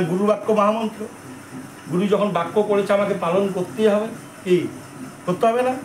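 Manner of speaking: ordinary delivery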